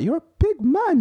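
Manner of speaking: high-pitched mock-incredulous telling-off; incredulous scolding. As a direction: scold a grown man like a toddler, pitched right up. Retold scolding of sulking driver.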